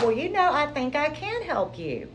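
imitating a chipper man offering help, high and bright; happy voice. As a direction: bubble over like a cheerful preacher on the phone. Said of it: "Richard just had this happy voice".

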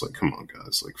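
breathy exasperated whisper. Real, not acted. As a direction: whisper it in disbelief.

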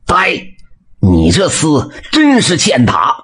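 high bark then a slow, heavy rebuke; stern shout. A narrator's reading. Blacksmith bursts in and roars at the bully. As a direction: roar at the scoundrel to stop. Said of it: "大喝了一声".

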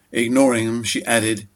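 ordinary delivery

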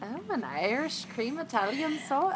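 put-on high, lilting go at an Irish accent; attempted Irish accent. Echoing the friend's drink order, attempted Irish accent. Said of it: "try to do an Irish accent".

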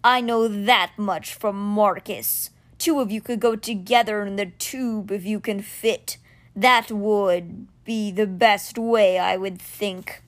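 very slow, grave stone cat; slow and low like a stone beast. Acted. Stone cat telling Alex the way, low and slow. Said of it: "Simber said in a low voice".

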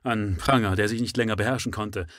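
plain reading voice

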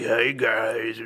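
hammed-up childish monster voice; kid's fake demon voice. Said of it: "a kid when they're trying to make that demon voice".

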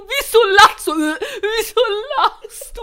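high, loud quote broken by laughter; laughing. Retold or imitated. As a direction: repeat the question while cracking up. Quoting girlfriend while laughing.

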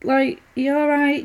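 high, slow, coaxing; gentle sing-song. Retold or imitated. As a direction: coax her like a fragile patient. Mia gently approaching Deborah.

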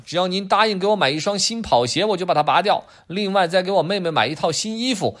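name your price like a little dealer; bargaining kid. Acted. Adam bargaining for a skateboard, cheeky child voice. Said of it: "亚当回答".

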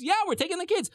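high, breezy mock reply; breezy affirmation. Moshe answering doubtful Pharaoh, breezy. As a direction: answer in a high, carefree lilt.

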